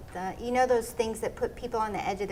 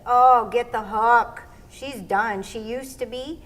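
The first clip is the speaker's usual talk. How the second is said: loud, slow jeering call; mock heckle; 8 dB louder, slower than usual. Performers mocking a washed-up act with a slow, loud jeer. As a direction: bellow it like a heckler in the stands. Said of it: "where they say".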